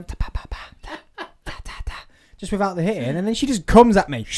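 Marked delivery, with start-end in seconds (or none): 0.0-2.1 soft unvoiced ta-ta-ta, imitating light taps; whispered tapping noises. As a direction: mime tiny strikes under your breath. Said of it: "Just go".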